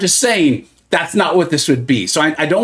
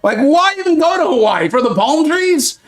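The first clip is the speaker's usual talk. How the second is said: mock outrage; 9 semitones higher than usual. Mocking viewer's outrage continued.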